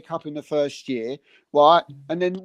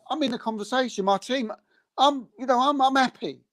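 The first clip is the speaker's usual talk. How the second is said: contented fan; 8 semitones higher, 4 dB quieter than usual. Retold or imitated.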